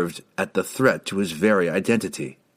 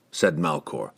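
plain reading voice

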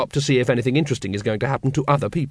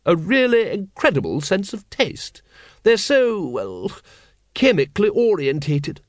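high lady's voice, searching for words; Lady Ramkin; 6 semitones higher than usual. Acted. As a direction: explain delicately, dipping on the hesitation. Sybil explains to Vimes.

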